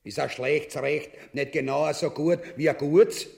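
ordinary delivery

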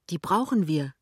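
lightly cheerful call; a touch of glee. A narrator's reading. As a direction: answer with merry satisfaction. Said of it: "ruft Lämmchen vergnügt".

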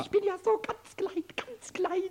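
high and small on "so ganz klein"; tiny-me voice. Acted. Kobold pleading to Meister Eder that he is too small to work, in a high tiny voice. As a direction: make yourself sound tiny, pitch up on "klein".

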